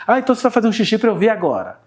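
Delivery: put-on a touch of cheerful mom coaxing her child; mild sing-song praise; encouraging mother.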